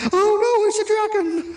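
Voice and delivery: high hammed-up gasp of alarm; mock fright. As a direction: squeal it like a scared actor.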